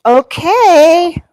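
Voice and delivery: a lifted, doubtful okay; lightly voiced hesitant agreement; high, resigned okay. Wife agreeing to husband taking dogs, lifted okay. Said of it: "And I said".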